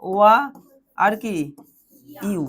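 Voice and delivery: ordinary delivery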